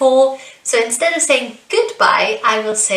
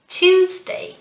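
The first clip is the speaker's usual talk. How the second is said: "Tuesday" with TUES pitched high; first-syllable stress; 9 semitones higher, 3 dB louder than usual. Shown as a demonstration. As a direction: punch TUES high in "Tuesday", drop on day. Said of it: "So twos is the stressed syllable".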